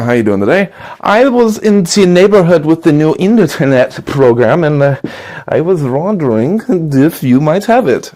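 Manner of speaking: a German door-to-door salesman greeting a customer; German accent. Acted. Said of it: "you know, German door-to-door salesman".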